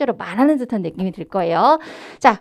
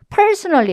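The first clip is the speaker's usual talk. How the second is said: personally with a louder stressed syllable, not lengthened; only louder; 6 semitones higher, much slower than usual. Shown as a demonstration. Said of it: "이렇게 크게만 하는 것이 아니라".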